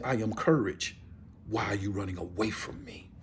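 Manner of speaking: low, soft lion reply; calm lion voice. Acted. Lion answering the man it chased, low calm voice.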